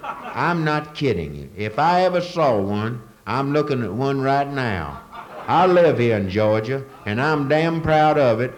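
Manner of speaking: slow, loud Southern local bragging; slow Southern drawl. Retold or imitated. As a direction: stretch it out like a proud Georgia local. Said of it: "I talked to another person who said".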